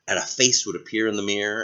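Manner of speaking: ordinary delivery, nothing marked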